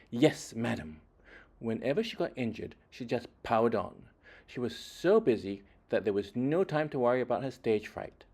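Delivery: ordinary delivery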